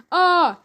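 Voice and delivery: high, loud scream as the car flips. A narrator's reading. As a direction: let out a terrified shriek. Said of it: "screamed everyone".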